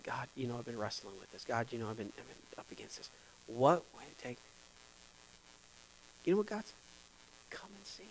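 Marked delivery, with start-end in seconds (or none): 0.0-3.1 soft, lowered prayer voice; quiet prayer. Retold or imitated. Pastor retelling his prayer to God, softly. 7.5-8.0 whispered. Retold or imitated. God's gentle answer to the pastor, whispered.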